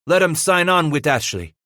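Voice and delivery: a touch of a Spanish-tinged growl at the free trappers. Acted. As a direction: growl it out in scorn. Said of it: "Vasquez growled".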